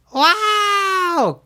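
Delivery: very high cartoon worm yelp; a tiny bug flung through the air; squeaky creature cry. A narrator's reading. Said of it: "Caterpie cried".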